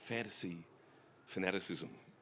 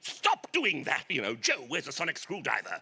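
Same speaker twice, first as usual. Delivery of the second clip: mother's Doctor Who voice; 5 semitones higher, 6 dB louder than usual. Retold or imitated. Son recalls mother nagging like a Doctor Who character, high and prim. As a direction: put on a prim scolding voice. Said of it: "she always used to talk like that".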